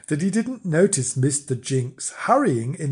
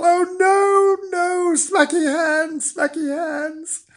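high, slow wail of dismay; drawn-out high-pitched dread; horrified; slower than usual. A narrator's reading. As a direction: wail it high, dragging out the words. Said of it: "with an awful shock".

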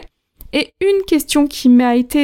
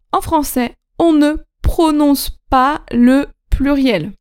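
measured teacher's emphasis. Real, not acted. Teacher spelling out a rule.

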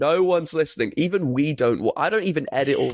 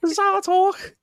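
squeaky high mock Peaky Blinders voice, laughing through it; high-pitched parody gangster voice cracking with laughter; mock Tommy Shelby. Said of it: "I'm Tommy Shelby".

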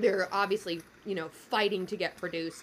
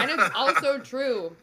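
laughing; 3 semitones higher, 8 dB louder than usual. Real, not acted. Laughing at the pecker joke.

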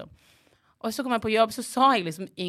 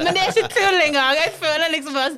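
ordinary delivery

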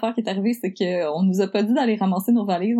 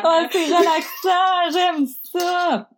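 mock ASMR voice; 9 semitones higher, much slower than usual. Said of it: "on peut faire du A S M R".

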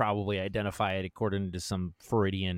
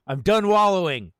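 higher and louder, laughing into a defiant cry; fired-up declaration; 9 semitones higher, 9 dB louder than usual. Acted. Declaring to Old Zay, fired up and loud. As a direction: shout it like you mean it, laughing. Amped manifesto outburst.

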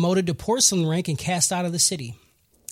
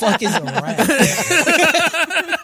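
slow, high, laughing through the question; barely getting it out through laughter; laughing disbelief; 7 semitones higher, 3 dB louder, much slower than usual. Retold or imitated. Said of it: "He's like".

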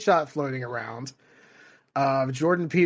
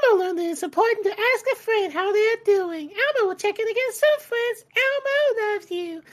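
Elmo voice. Retold or imitated. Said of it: "I'm not doing that in the Elmo voice".

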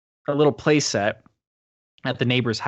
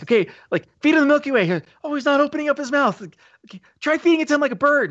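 mock dumb voice; 14 semitones higher, 4 dB louder, faster than usual. Mocking dumb rescue plan.

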